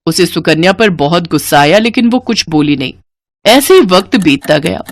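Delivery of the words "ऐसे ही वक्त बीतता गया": usual speaking voice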